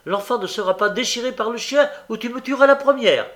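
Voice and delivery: fast, high, loud defiance; defiant cry. A narrator's reading. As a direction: cry out in a desperate rush. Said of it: "cria la jeune fille".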